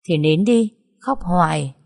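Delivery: ordinary delivery, nothing marked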